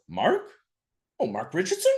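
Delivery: retold cop's rising, questioning surprise; surprised recognition. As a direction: act out recognising someone you know. Cop recognises the driver.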